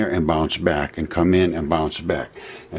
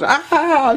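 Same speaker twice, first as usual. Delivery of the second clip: retold scream. Wife jolted by the solenoid, high imitated shriek. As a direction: yelp as if jolted by a shock. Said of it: "She starts screaming".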